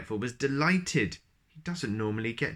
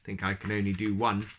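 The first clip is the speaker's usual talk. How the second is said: ordinary delivery, nothing marked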